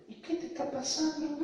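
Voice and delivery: very high mocking question; ironic. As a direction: squeak it in mock concern. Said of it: "Como irónica".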